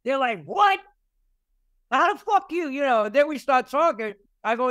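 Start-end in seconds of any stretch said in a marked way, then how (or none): 0.4-2.7 like they can't believe he knows; stunned disbelief. Retold or imitated. Stunned guys reacting to old names.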